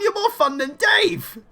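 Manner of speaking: high, loud, delighted cry; gushing high squeal. Acted. Character cheer, teasing.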